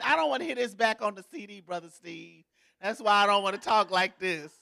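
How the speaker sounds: ordinary talk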